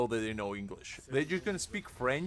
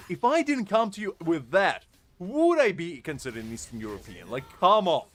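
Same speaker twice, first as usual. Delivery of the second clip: ordinary talk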